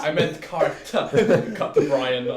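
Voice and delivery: laughing. Real, not acted. Laughing over the joke.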